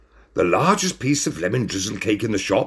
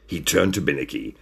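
ordinary delivery, nothing marked

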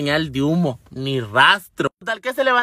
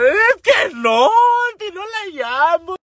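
lamento agudo, fuerte y arrastrado; llanto actuado; 11 semitones higher, 3 dB louder, slower than usual. Retold or imitated. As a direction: protesta llorando, estirando cada palabra. Sigue llorándole al vecino. (berrinche inconsolable exagerado)

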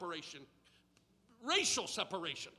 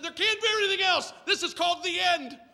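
higher, louder, fast exasperated protest with a laugh; incredulous outburst; 11 semitones higher, 11 dB louder than usual. Retold or imitated. Telling the movie friend, louder and higher in laughing disbelief. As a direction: blurt it out like you can't believe there's more. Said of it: "I said".

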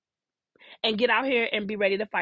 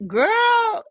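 put-on high, loud kid's protest; a young girl's disbelieving cry at her mother's advice; indignant little girl; 10 semitones higher, 5 dB louder than usual. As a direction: squeal it like an outraged child. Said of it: "I used to be like".

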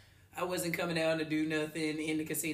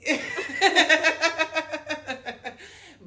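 long, high peal of laughter; big laugh; 10 semitones higher, 10 dB louder than usual. Real, not acted. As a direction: crack up at your own punchline. Cracks up at own punchline.

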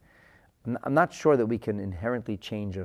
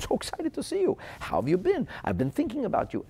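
much higher, gushing hello; mock excited greeting; 12 semitones higher, 4 dB louder than usual. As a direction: play the thrilled friend. Good friends greeting each other.

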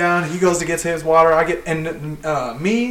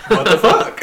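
quicker questioning echo, ending on a rise; retold incredulous echo; 5 semitones higher than usual. Worker answering the furious boss, amused incredulous echo. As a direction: throw it back higher, half laughing.

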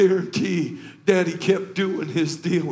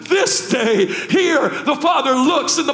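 high, loud, building sermon cry; full-force preaching; 8 semitones higher, 5 dB louder than usual. Acted. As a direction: preach it up to a shouted climax.

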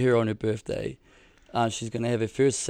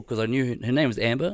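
ordinary talk

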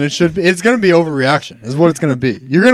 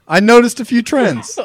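words cracking with laughter; barely holding it together; laughing; 7 semitones higher than usual. Real, not acted.

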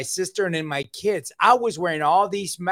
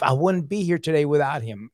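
usual speaking voice

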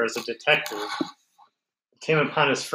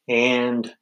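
normal talking voice

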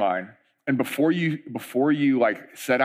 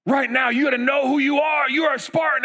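hyped rallying cry; 11 semitones higher than usual. Retold or imitated. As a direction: fire them up.

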